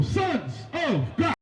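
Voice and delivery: slow, drawn-out call to the crowd; high, stretched-out proclamation; rallying call. Real, not acted. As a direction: call it out word by word. Rallying call closing the teaching.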